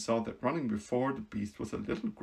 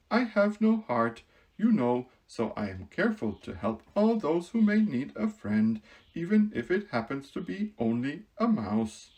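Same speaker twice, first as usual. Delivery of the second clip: louder, higher earnest tin man; Tin Woodman voice; 4 semitones higher, 6 dB louder than usual. Acted. Woodman explaining to the mouse, earnest and kind.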